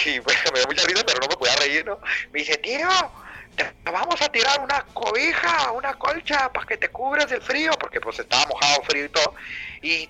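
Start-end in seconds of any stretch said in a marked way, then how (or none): 2.6-7.7 voz aguda del amigo que avisa; amigo español avisando. Retold or imitated. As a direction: imita al amigo gritando desde arriba.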